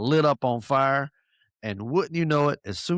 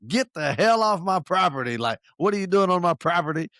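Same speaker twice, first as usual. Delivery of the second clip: higher, mock angry yell at a trespasser; retold yelling; 6 semitones higher, 3 dB louder than usual. Landowner chasing a CNN reporter off her property, mock yell. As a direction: imitate the irate landowner, half-shouted. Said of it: "this lady started yelling at him".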